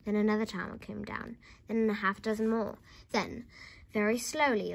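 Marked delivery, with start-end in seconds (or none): none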